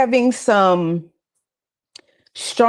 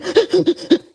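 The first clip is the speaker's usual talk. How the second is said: sharp high gasp; panicky gasp; 9 semitones higher than usual. Shown as a demonstration. Showing how panicked breathing sounds, sharp gasp. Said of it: "breathe like that".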